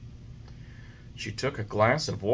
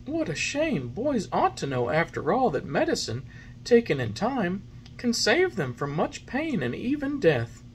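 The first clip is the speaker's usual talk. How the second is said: a hint of a gentle fairy; lightly voiced fairy; 11 semitones higher than usual. A narrator's reading. Fairy gently lecturing the puppet. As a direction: mildly chide the boys.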